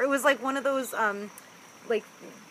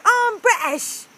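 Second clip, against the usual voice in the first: higher, louder, slow mock-British accent of a friend; bad British accent; 11 semitones higher, 10 dB louder, slower than usual. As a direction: ham up a terrible British accent, then slip out on "yo". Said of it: "he says things like".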